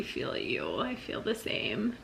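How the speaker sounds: whispered. Real, not acted. Soft reassurance to friend.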